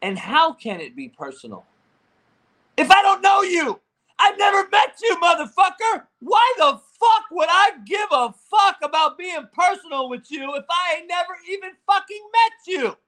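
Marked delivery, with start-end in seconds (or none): 2.8-13.0 high, loud exasperated outburst; heated yelling. Real, not acted. Host asking strangers why it would be personal, heated yelling. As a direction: shout it in indignant disbelief.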